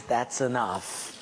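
a hint of the Lord halting you; lightly voiced God. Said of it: "It just stops it and says".

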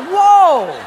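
can't believe what he hears; stunned disbelief. Acted. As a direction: Haole guy reacting to the absurd answer, stunned. Haole guy stunned.

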